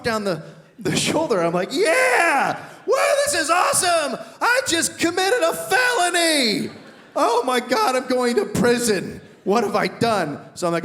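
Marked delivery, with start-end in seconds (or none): 1.7-10.4 high, loud shouted glee turning to panic; high shouting hysteria; panicked excitement. Retold or imitated. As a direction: yell it thrilled, then horrified. Panicked thrill after felony.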